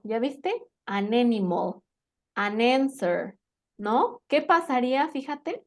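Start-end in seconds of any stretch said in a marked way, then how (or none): 0.8-3.4 "An animal, an answer", each linked through the n; todo junto. Shown as a demonstration. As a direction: join "an" onto "animal" and onto "answer". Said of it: "suena todo junto". ("An animal, an answer" con la n enlazada)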